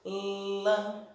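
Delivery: "lã" held out by itself; "lã" isolated and lengthened; syllable said alone. Shown as a demonstration. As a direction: draw out "lã" as a single stressed syllable. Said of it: "Qual foi a sílaba que mais se alongou".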